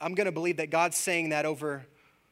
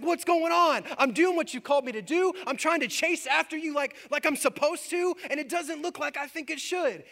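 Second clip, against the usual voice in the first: higher, louder frustrated complaint; frustrated Moses; 11 semitones higher, 5 dB louder than usual. Retold or imitated. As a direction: vent at God like a wronged servant. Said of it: "he gets kinda mad at God".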